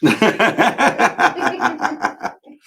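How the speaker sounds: loud staccato belly laugh. Real, not acted. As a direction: burst out in a rapid run of ha-ha-ha. Laughing at listener's stereotype remark.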